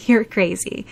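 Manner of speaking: ordinary delivery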